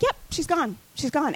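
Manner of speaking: imitating dad's unbothered reply, high-pitched; no big deal. Dad answers his distraught daughter about the death, no big deal. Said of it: "he just almost seems like it's no big deal".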